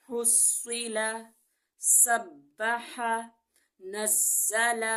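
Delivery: slow, careful Quranic word drill; tajweed recitation. Shown as a demonstration. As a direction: recite each word slowly and clearly. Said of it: "recites tajweed practice words". (تجوید کے ساتھ آہستہ تلاوت)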